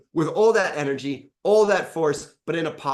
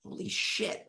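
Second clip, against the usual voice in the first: breathy whispered shock, much softer; stunned whisper; 6 semitones higher, 10 dB quieter than usual. Retold or imitated. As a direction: gasp it out under your breath, amazed. Retold own stunned reaction.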